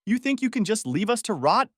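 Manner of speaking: high-pitched accusing question, voiced; angry hiss. A narrator's reading. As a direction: confront them in acted outrage. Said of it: "he hissed".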